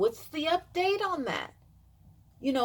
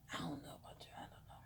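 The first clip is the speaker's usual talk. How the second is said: whispered aside; 8 semitones lower, 23 dB quieter, much faster than usual. Real, not acted. Speaker to viewers about Kamala and Joe, whispered aside. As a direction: drop to a low whisper for a quick aside.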